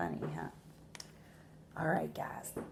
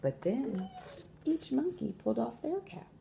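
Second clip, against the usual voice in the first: measured storytelling with long pauses; expressive picture-book narration; story read to children; 3 semitones higher than usual. A narrator's reading. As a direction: read aloud to young listeners, stressing key words.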